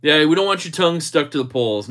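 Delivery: ordinary delivery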